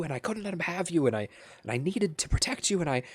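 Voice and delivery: high, fast, pleading ramble through tears; sobbing apology. Acted. Captured man sobbing his apology to Petal. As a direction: break down, rushing the words out. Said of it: "he just starts sobbing and speaking incoherently".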